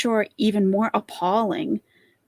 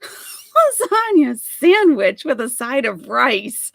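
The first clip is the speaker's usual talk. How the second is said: mock disbelief; 4 semitones higher, 6 dB louder, slower than usual. Mocking incredulous reaction.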